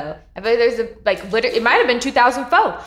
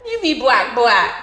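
laughing tease; 3 semitones higher, 5 dB louder, slower than usual. Real, not acted. Friend teasing back.

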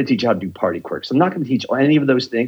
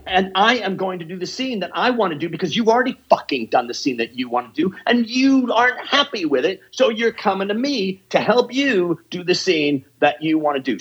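higher-pitched, emphatic rant, not shouted; impassioned insistence; 7 semitones higher than usual. Real, not acted. Coach justifying their methods to students, impassioned. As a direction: press each point home with raised pitch.

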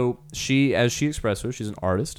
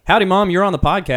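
loud, high-pitched howdy to mom; big, boisterous phone hello; hollered greeting; 10 semitones higher, 8 dB louder than usual. Real, not acted. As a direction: call out brightly into the speakerphone.

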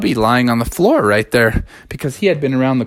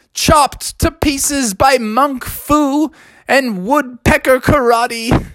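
higher, slow, sing-song excuse; singsong cub; 9 semitones higher, slower than usual. Acted. Bubby excuses himself to his dad, singsong. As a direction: drag the excuse out like a tune.

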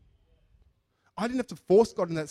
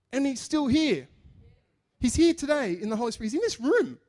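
higher, rising preaching build; 7 semitones higher, 5 dB louder than usual. Acted. Preacher telling the congregation Jesus is here, preaching. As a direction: proclaim his presence.

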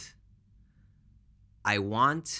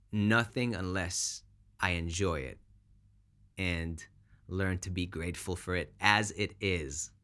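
ordinary delivery

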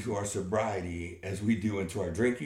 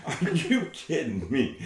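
laughing disbelief; 4 semitones higher, 4 dB louder, slower than usual. Real, not acted.

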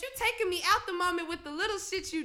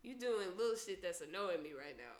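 soft, low grumble under her breath; muttered complaint; 7 semitones lower, 10 dB quieter than usual. Retold or imitated. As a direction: keep it quiet, fed up. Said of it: "I was like".

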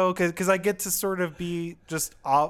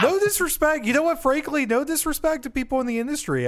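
emphatic disclaimer; 9 semitones higher than usual. Real, not acted.

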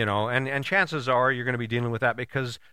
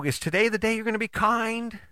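higher-pitched sweet challenge, no anger; playful challenge, not mad; 9 semitones higher than usual. Acted. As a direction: ask it lightly, high and teasing. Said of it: "you don't have to get all mad".

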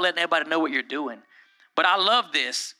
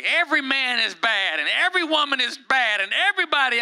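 higher-pitched mock griping, climbing with each phrase; mock complaining; 6 semitones higher than usual. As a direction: imitate an over-the-top blanket complaint. Imitating sweeping social media complaints.

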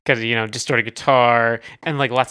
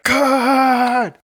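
high, loud shouted cry; 12 semitones higher, 3 dB louder than usual. Retold or imitated. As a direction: belt it out like a metal scream. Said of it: "they just, like, shout".

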